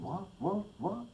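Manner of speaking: high, hushed puzzled repeat; stunned, asking what just happened; baffled what. Retold or imitated. Thinking in the car as another driver rages, baffled. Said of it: "You're thinking".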